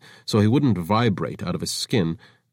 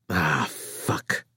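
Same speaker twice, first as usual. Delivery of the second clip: weary curse; 5 semitones lower, 5 dB quieter than usual. Acted. Markefa dismayed.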